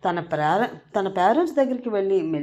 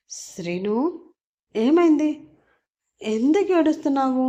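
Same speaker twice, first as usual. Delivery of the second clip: high, louder, scared asking; loud frightened question; 6 semitones higher, 3 dB louder than usual. Acted. Ammu frightened, asking crying Srinu what happened, loudly. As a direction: call out to him, nervous but firm. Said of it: "కొంచెం గట్టిగా బెదురుగా అడుగుతుంది".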